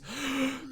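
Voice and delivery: sharp high mock gasp of outrage; offended gasp. Eddie gasping at James, hammed-up offended gasp. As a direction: gasp as if deeply insulted. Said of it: "Eddie goes".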